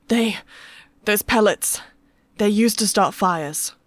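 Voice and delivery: loud, halting, shaken answer; shaking voice. A narrator's reading. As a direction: answer haltingly under threat. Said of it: "Toby's voice shook".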